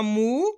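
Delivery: मू drawn out, gliding upward; long vowel. Shown as a demonstration. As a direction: hold the oo of मू and let it rise. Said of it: "खींच खींच के इसका उच्चारण".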